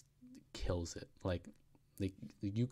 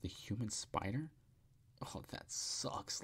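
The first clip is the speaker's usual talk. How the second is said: mock disgusted ring announcer, the last words slow and whispered; complete disgust; 3 semitones higher, 4 dB quieter, slower than usual. Ring announcer mocking a wrestler's name, disgusted whisper. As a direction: drop it like you couldn't care less. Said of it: "in complete disgust".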